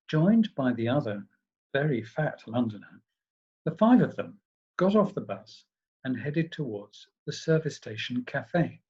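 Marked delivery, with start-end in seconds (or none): none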